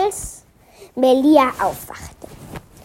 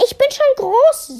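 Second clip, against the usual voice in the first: higher-pitched, lightly voiced child protest; insistent child; 12 semitones higher than usual. A narrator's reading. Melia tells her mother she is big, insistent. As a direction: insist like a schoolgirl.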